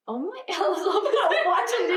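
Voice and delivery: giggling through the line; laughing. Real, not acted. Laughing as she tells friends about her dog.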